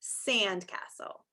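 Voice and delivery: "sandcastle" slow and soft, long S; emphasized S sound. Shown as a demonstration. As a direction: draw out the S opening "sandcastle". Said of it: "if you hear the s sound".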